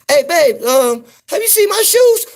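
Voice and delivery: very high, loud mock woman's voice; hammed-up high wife voice; Southern wife. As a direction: squeak it out like the wife asking. Mimicking Southern couple.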